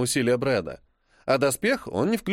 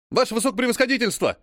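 urgent breathless report; 9 semitones higher, faster than usual. Acted. Adjutant bursts into command post.

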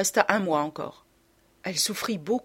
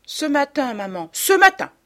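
put-on high, loud childlike self-defence; pleading objection; insistent protest; 8 semitones higher, 7 dB louder than usual. A narrator's reading. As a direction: insist to a scolding parent, hitting it harder each time. Boy protests to scolding mother.

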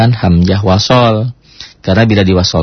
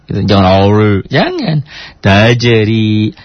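usual speaking voice

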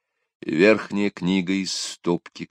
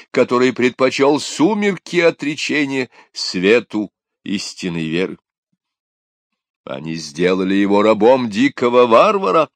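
solemn booming sermon; 6 semitones higher, 7 dB louder than usual. A narrator's reading. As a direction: preach it grandly to the whole crowd. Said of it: "торжественно воздел руки к небу, и голос... без труда разнёсся над площадью".

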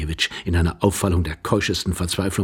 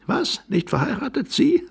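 shrill, much higher squeak of disbelief; can't believe what he hears; incredulous disbelief. Acted. Young man scoffing at the old man's claim, incredulous.

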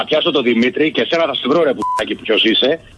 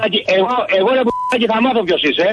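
high-pitched furious threatening; furious victim threatening the prankster, pitched high; angry threat; 7 semitones higher than usual. Real, not acted. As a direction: threaten him through rising anger.

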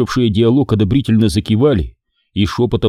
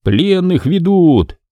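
high, drawn-out warning call; крик-предупреждение; 8 semitones higher, much slower than usual. A narrator's reading. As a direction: call it out high, stretching the words. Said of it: "раздался крик". (протяжный выкрик-предупреждение)

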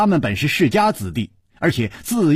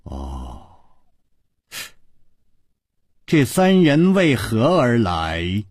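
pondering matriarch; much slower than usual. A narrator's reading. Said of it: "沉吟了半晌". (低沉缓慢地沉吟发问)

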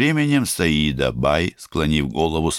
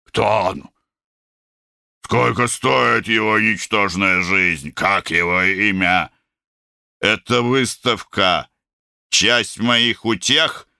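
higher, heavy-breathing jealous rage; angry panting demand; 5 semitones higher, slower than usual. Acted. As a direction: huff out the questions slowly. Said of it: "Тяжело пыхтя".

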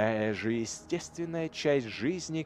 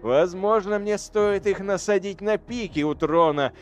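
loud, mocking, relishing every word; gloating voice; 5 dB louder than usual. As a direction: savour the cruelty out loud. Til gloats about severed heads.

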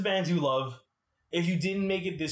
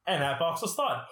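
ordinary delivery

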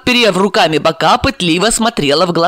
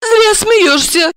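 annoyed; much slower than usual. Acted. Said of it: "рассердилась Ольга".

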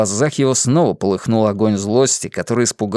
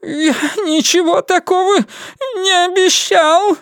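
frightened pleading. Acted. Terrified prisoner pleading.

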